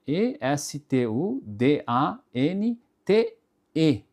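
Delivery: "E S T U D A N T E" letter by letter, slowly; spelling aloud. Shown as a demonstration. Teacher spells the word for students, slow letter by letter. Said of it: "escrever a palavra estudante".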